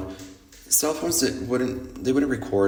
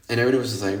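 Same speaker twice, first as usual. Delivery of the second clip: ordinary talk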